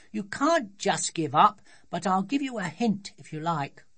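put-on high, loud child's voice; high-pitched bossy child, encouraging; bossy little daughter. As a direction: play an insistent small girl.

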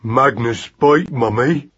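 put-on slow, sullen baby talk; very sulky. A narrator's reading. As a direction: threaten it like a pouting child. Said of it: "in very sulky tones".

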